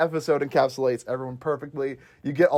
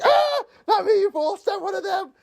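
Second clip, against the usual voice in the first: much higher, squealed complaint; mock pained yelp. Retelling the stabbed brother, high pained yelp.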